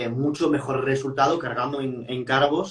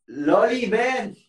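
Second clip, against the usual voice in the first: calling out; 5 semitones higher, 4 dB louder, much slower than usual. Real, not acted. Calling Loli over.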